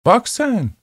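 high, sly shopkeeper; a chirpy old shopkeeper. Acted. Shopkeeper speaks to customer.

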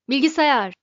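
shouting. A narrator's reading. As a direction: bark the name to summon it. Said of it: "diye bağırdı Zaphod". (yüksek sesle bağırarak)

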